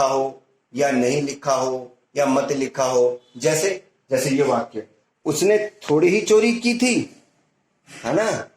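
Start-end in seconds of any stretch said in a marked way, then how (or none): 5.2-7.2 high, scoffing rise on "चोरी" that falls away; dismissive "उसने थोड़ी ही चोरी की थी"; sarcastic negative tune. Shown as a demonstration. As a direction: say "थोड़ी ही चोरी" mockingly, meaning he didn't steal. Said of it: "इसमें अनुतान परिवर्तित होती है".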